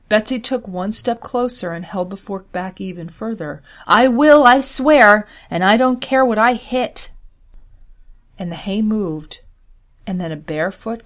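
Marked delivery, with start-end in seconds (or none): 3.9-7.1 loud and higher, a threat she means; woman yelling at a hidden intruder, fierce shout. Acted. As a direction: shout it down at the haystack, fork raised. Betsy threatens again, closer.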